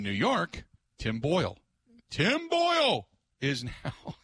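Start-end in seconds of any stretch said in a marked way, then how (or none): 2.1-4.1 drawn-out, high-pitched mock fanfare; mock-grand announcement. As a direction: blow up the name like huge breaking news. Hypes minor signing, then laughs.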